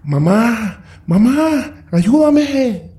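very high, desperate screaming for mother; terrified scream. A narrator's reading. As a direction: scream for help in sheer terror. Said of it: "emitió el grito más aterrador".

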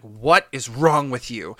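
loud, slow demand; angry shout. Retold or imitated. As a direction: yell it in his face. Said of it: "I'm like".

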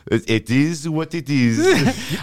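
put-on slow, high-pitched French accent. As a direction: exaggerate her French accent, drawing it right out. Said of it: "French accent".

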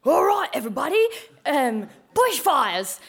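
mock emergency broadcast to everybody; hammed-up bushfire bulletin voice; radio announcer. Acts out radio bushfire warning.

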